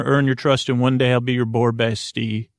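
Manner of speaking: ordinary talk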